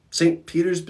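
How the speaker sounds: high, questioning "Saint Peter's?"; hoping for the grand commission; hopeful surprise. Retold or imitated. Michelangelo asking the Pope, high hopeful question. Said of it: "He goes".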